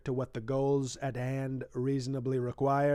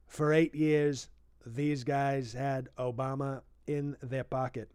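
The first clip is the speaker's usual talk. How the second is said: ordinary delivery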